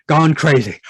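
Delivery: ordinary talk, nothing marked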